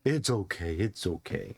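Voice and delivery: low, soothing murmur; calm the animal gently. Acted. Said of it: "And he's like".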